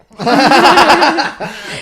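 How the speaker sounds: high, loud laugh; cracking up; big high laugh; loud burst of laughter. Real, not acted. Laughing at own story.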